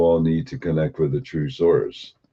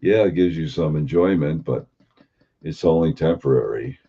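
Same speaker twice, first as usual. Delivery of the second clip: ordinary delivery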